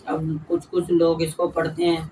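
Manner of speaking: ordinary talk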